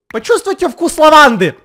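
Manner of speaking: mock sales shout. Mocking zombie sales pitch.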